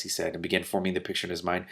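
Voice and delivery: ordinary delivery, nothing marked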